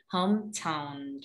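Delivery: "hometown" slow, T released like ch; aspirated T in hometown. Shown as a demonstration. Teacher modelling the fix, slow "hometown" with puffed T. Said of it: "harusnya pakai C".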